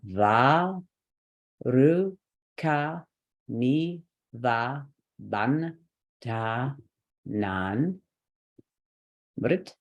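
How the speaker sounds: slow mantra, one syllable at a time. Shown as a demonstration. Teacher doing the whole mantra, slow separated syllables. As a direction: sound out every syllable evenly with clear gaps. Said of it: "do the whole thing slow in syllables".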